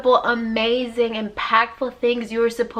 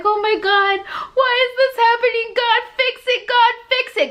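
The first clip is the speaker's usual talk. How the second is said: high, whiny mock plea to God; a high mock whine to God; whiny pleading; 11 semitones higher than usual. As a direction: wail it like a spoiled child begging.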